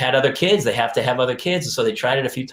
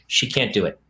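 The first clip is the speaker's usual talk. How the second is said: ordinary talk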